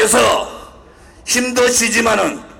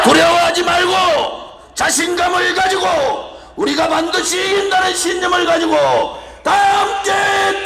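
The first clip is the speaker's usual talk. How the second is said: roaring rallying speech; 4 semitones higher than usual. Real, not acted. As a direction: fire them up with full voice.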